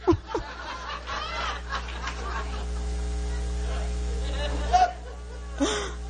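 long high laugh breaking into tears; laughing cry. Real, not acted. Testifier recalls crying nonstop after prayer, laughing through tears. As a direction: laugh until it turns to crying.

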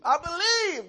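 high, loud plea; crying out. Acted. Preacher repeats the father's plea, crying out. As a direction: cry it out with force.

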